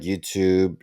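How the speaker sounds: normal talking voice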